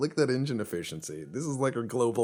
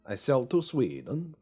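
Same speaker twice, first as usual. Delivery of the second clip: put-on higher, lilting joke Swedish accent; mock Swedish accent; 5 semitones higher than usual. Player selling old ships to Sweden, mock Swedish accent. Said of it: "That's my impersonation of Swedish people".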